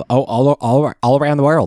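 high-pitched mimicry of the zoo owner; Joe Exotic. Host showing cohost how he talks, high-pitched impression. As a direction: lift the voice high to mimic him. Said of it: "That's how he talks".

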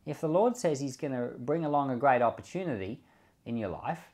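normal talking voice

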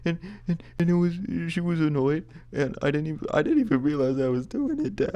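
high, loud blubbering to dad; mock tearful. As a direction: stammer it out in exaggerated sobs. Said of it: "I burst into tears".

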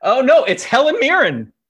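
sudden high, loud realization; excited high exclamation. Real, not acted. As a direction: blurt it out, delighted.

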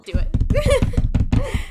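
high, loud shouted cheer; loud, high-pitched egging on; excited shout. Real, not acted. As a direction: yell it out eagerly, way up high. Urging the final score.

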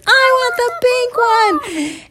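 very high, slow, loud demand; hammed-up kid's wail, stretching every word. Candy fights Bar for pink straw, in drawn-out yell.

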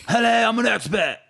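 high, put-on expat greeting; mock expat voice. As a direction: play a stiff foreigner introducing himself. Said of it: "how an expat speak".

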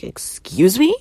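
loud, high offended excuse me; affronted excuse me; indignant excuse me. Retold or imitated. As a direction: recoil in disbelief. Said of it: "I was like".

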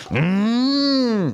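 high strained mm of someone yanking hard; a strained high effort sound as the ramp is forced out; imitated straining grunt. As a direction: strain with effort, pulling something heavy. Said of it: "You can hear it".

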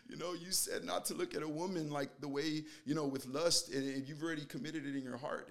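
high, tearful plea, acted out; retold tearful confession. Driver repeating Jesus's words back to God, imitated tearful high voice. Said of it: "And I'm, like, crying".

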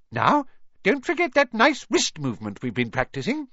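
high squeaky little professor voice; squeaky Flitwick. A narrator's reading. Flitwick reminding the class, squeaking high. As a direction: pipe it up high like a tiny wizard. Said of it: "squeaked Professor Flitwick".

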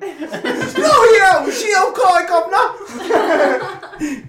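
very high, loud mock Cockney; thick English accent. Imitating brother scared into English, loud mock English accent. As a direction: shriek it in a hammed-up English accent, last word stretched. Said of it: "Just starts going off in English".

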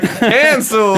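high, loud, laughing fake protest; laughing mock cancel. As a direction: cry it out through laughter like a mock heckler. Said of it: "I was about to be like".

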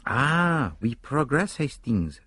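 lightly voiced accented detective, pleased; pleased Poirot. A narrator's reading. Poirot to Hastings as the Bakers leave, lightly voiced and pleased.

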